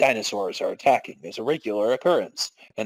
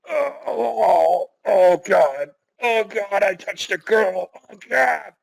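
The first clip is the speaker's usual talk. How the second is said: high, panicked babbling; flustered guard; 7 semitones higher, slower than usual. Acted. Guard overwhelmed after touching a girl, high and frantic. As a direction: lose it completely.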